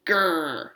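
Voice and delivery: long mock-angry "grr"; dog growl. A narrator's reading. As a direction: growl like a child playing dog.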